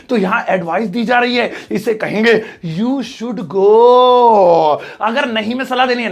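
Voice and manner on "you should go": very slow, loud, stretched GO; slow, booming classroom example; drawn-out model sentence. Shown as a demonstration. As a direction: hold the last word long like a teacher dictating. Teacher reads out English example answer.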